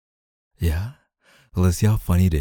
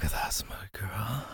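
slow soft whisper; 10 dB quieter, slower than usual. Acted. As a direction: praise her in a hushed breath. Intimate roleplay, whispered praise.

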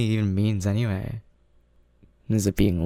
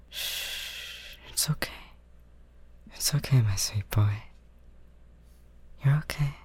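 soft soothing whisper; 3 semitones higher, 7 dB quieter than usual. Acted. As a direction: hush him gently, barely voiced. Soothing a crying boyfriend.

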